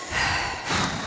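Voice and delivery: long, soft, breathy sigh; big sigh. Real, not acted. Commentator sighs over the tense derby game, big breathy sigh. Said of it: "Big sighs".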